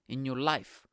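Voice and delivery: ordinary talk, nothing marked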